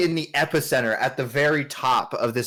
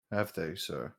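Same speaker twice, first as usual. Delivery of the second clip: low, soft, deferential question; understated knight, lower and softer; lightly voiced loyal knight; 6 semitones lower, 11 dB quieter than usual. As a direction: answer your lord quietly. Game master voices the knight.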